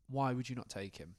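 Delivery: normal talking voice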